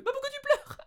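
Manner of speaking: very high, loud squeaky question; imitated startled question. Colleague asking the crying learner why, high imitated question. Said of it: "elle me regarde, elle me fait".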